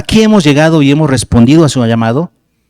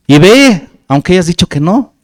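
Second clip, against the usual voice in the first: ordinary delivery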